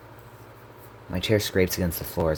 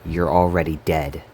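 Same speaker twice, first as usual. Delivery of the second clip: louder, firm fall on "already dead"; firm reminder; 5 dB louder than usual. A narrator's reading. Narrator cutting off the brother as self-reminder, firm insistence. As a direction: drive "already" home, louder. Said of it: "I repeat, reminding myself".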